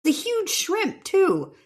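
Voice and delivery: high-pitched, drawn-out amazement; real wide-eyed astonishment; amazed exclamation. Real, not acted. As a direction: marvel at it, pitch shooting up. Marvelling at the shrimp's size.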